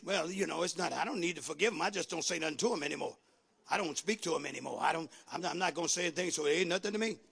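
low, soft, quick excuse-making; mock inner voice of a grudge-holder; muttered self-justification. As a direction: mumble excuses as if thinking to yourself. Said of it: "I heard somebody saying that".

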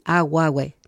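aguahue said slowly and clearly, a-gua-hue; careful model pronunciation. Shown as a demonstration. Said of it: "Para que lo entienda bien".

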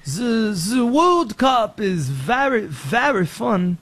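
slow, hammed-up French accent; mock a Frenchman praising the World Cup, slowly. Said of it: "Be like".

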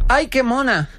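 high, gushing voice imitating the date; high-pitched delighted cooing; delighted girlfriend. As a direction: mimic her cooing over the bug.

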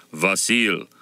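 gruff Russian-peasant way of saying Vasil; Russian peasant accent. A narrator's reading. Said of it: "answers in Russian".

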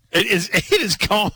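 high, giggly talk through laughter; can barely get words out laughing. Real, not acted. Breaks up laughing over texts.

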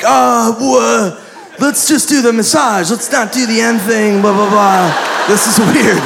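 retold panic. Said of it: "and I panic".

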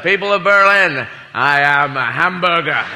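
mock-grand orator, slowing on the punchline; President Kennedy. Touring president addresses Hamburg, mock-grand oratory. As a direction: declaim it like a solemn proclamation. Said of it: "he'd come through Hamburg".

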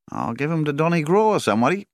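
high, loud livestock agent's voice; agent Colin. A narrator's reading. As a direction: press the client, pitched right up. Agent Colin pressing his client.

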